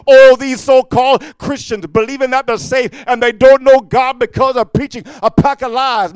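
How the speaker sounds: high, loud fiery sermon delivery; full-force preaching. Real, not acted. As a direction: preach it hard, driving through each phrase.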